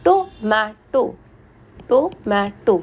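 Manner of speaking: Tomato said in three parts, टो, मे, टो; split into syllables. Shown as a demonstration. Teacher showing three sounds in tomato, syllable by syllable. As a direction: break tomato after each syllable. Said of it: "तीन sound आ गई".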